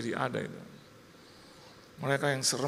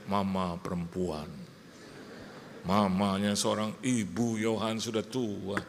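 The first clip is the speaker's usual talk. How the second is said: ordinary talk, nothing marked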